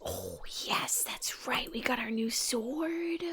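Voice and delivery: slow, hushed whisper. Acted. Gamer whispering about her new sword, slow whisper. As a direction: whisper the discovery slowly.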